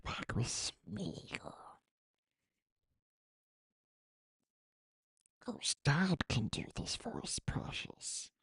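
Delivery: whispery, hissing Gollum creature. Acted. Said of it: "it went to Gollum".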